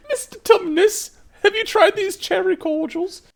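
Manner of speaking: very high, loud falsetto; mock storybook voice. Teasing about Mister Tumnus and sweets, mock falsetto. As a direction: squeal it in a put-on falsetto.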